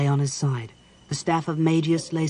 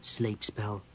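soft grim aside; 5 semitones lower, 9 dB quieter than usual. Acted. Caramon names the spell.

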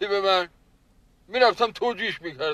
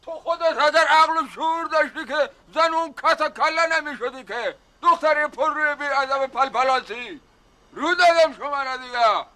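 higher, sharp scolding at his daughter; angry shout at daughter; 8 semitones higher than usual. Acted. Father snaps and scolds his daughter in a raised, angry voice. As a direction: lash out at her, voice pitched up. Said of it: "دیدی این چجوری سر من داد زد؟".

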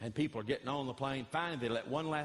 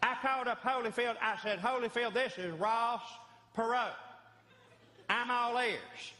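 Ross Perot; 10 semitones higher than usual. Retold or imitated. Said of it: "I went right into Ross Perot".

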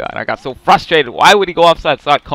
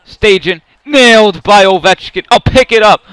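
excited call; 6 semitones higher, 7 dB louder than usual. Real, not acted. Big hit in game.

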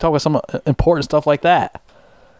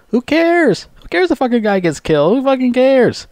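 much higher, mock-indifferent yelp; sarcastic outrage; 12 semitones higher than usual. Vlogger mocks the YouTuber's indifference, sarcastic high yell. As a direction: squeal it as if nobody cares.

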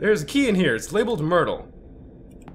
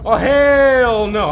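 slow, stretched "hell no" with weight on "hell"; drawn-out refusal; 3 semitones higher, 6 dB louder, much slower than usual. Real, not acted. Streamer refusing to go near the ghost, slow drawn-out "hell no". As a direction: drag out every word of "Oh, hell no!".

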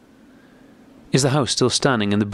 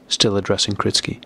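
ordinary delivery

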